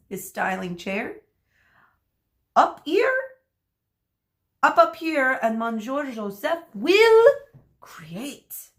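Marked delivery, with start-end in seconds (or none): 2.5-7.3 much higher and louder, showman's call; hammed-up French stylist beckoning; eager invitation. 7.8-8.7 breathy dramatic whisper; whispered final reveal; whispered flourish. Acted. Stylist's dramatic finish.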